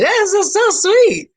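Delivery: very high, slow and swooning; swooning gush. Retold or imitated. Smitten woman swooning over her boyfriend's gesture, very high and slow. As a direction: melt over how sweet he is. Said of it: "I was like".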